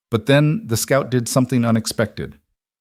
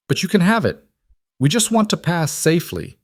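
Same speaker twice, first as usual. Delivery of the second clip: much higher woman's voice, urgent; Leia pleading; 9 semitones higher than usual. Acted. Woman offering the outlaw her money, higher and pleading.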